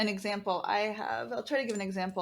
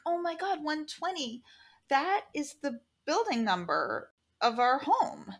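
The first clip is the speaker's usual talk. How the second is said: high, stunned "oh my God"; shocked gasp; 6 semitones higher than usual. Retold or imitated. To the medium, family suddenly stunned. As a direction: realize it all at once, voice jumping up.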